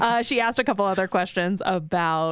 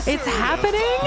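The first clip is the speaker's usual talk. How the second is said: excited gasp; 10 semitones higher, 3 dB louder than usual. Real, not acted. Excited reaction to segment.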